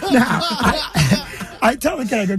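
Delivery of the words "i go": usual speaking voice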